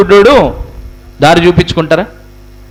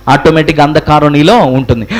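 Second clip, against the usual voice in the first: ordinary delivery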